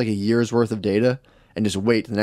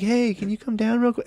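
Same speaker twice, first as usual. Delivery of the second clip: higher-pitched, sweetly innocent ask; casual innocent request; 10 semitones higher than usual. Retold or imitated. Girlfriend replaying her request to boyfriend, innocently sweet. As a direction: imitate a partner's harmless, easygoing request. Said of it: "this is the tone you use".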